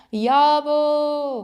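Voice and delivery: long high call across a field; shouting. A narrator's reading. Boy calling his friend lost in the corn, shouting. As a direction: call out loud, drawing out the vowels. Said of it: "кричу".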